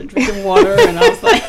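high, loud laughing talk. Real, not acted. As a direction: speak through real laughter. Laughing while telling.

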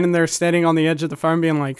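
normal talking voice

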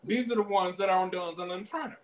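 normal talking voice

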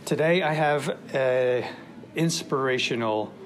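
loud, high announcer welcome; radio host greeting his audience; podcast host intro. Acted. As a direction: hype up the listeners as the show opens.

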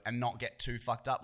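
ordinary delivery

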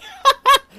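a high squealing burst of laughter; shrieking laugh. Real, not acted. Host squeals laughing at her own joke.

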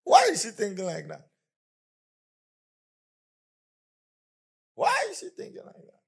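loud shrieking wife; screaming wife. Retold or imitated. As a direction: shriek it in disbelief, rising to the top. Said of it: "she's screaming".

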